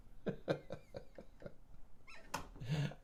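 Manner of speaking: soft airy laugh in disbelief; a quiet, wheezy laugh at his own bad day; laughing at a zero day; breathless laugh. Real, not acted. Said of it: "I don't know why I'm laughing".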